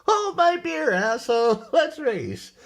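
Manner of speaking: high, loud, laughing bravado; cocky mock shout. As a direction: accept the challenge with a loud laughing yell. Trader accepting race, laughing.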